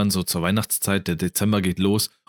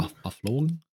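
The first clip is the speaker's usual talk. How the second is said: mocking echo; 6 semitones higher, 6 dB quieter than usual. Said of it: "Der Moment ist verflogen".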